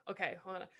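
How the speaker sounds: ordinary talk, nothing marked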